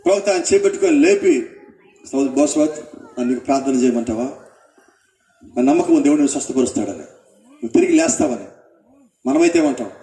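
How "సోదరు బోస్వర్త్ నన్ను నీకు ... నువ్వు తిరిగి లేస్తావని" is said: lower, gently voiced prophet asking to pray; prophet's gentle plea. Prophet asks bedridden Bosworth if he may pray, low and gentle. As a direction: speak low and kindly, as a prophet offering prayer.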